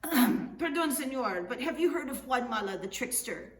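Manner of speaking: pompous governor. Acted. Governor on horseback questioning a stranger, loud and lofty. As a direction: address him like a grand Spanish governor.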